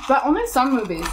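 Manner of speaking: ordinary talk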